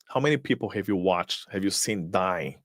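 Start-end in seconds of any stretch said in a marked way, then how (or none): none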